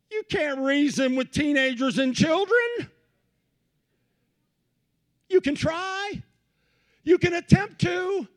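high loud preacher shout. Real, not acted. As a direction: belt it out high.

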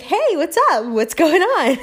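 high, loud, bouncy hello; cheery greeting. Acted. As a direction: greet everyone like you're loving life. Acting a happy greeting to passers-by.

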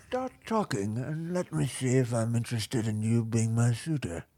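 hushed, affected host voice; aloof rich bachelor. Acted. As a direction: murmur it like a haughty millionaire.

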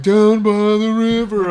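Chris Farley impression. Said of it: "Chris Farley quote".

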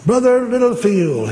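high, loud, stretched-out name; calling out. Retold or imitated. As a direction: hail him excitedly by name. Calling out to the pastor.

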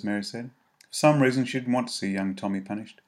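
ordinary delivery, nothing marked

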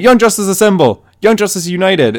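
high, excited mock battle cry; happy rallying cry. Bart rallying his team as aliens retreat, high and happy. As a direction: call the team together like an overjoyed kid hero. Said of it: "he's just so happy".

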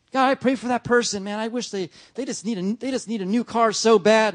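mock-sincere prayer voice; praying for someone else's new car; pleading prayer. Mock prayer request to God.

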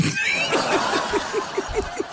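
breathy embarrassed giggle; nervous laugh. Real, not acted. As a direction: laugh off the slip. Embarrassed after forgetting lyrics.

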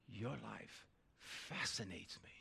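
quiet sincere fascination, slowed; tender therapist. Retold or imitated. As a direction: lean in and mean every word. Therapist leans in.

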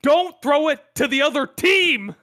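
loud, high, drawn-out yell; screaming coach. Acted. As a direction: holler slowly in exasperation at your quarterback. Acting out coach screaming at player.

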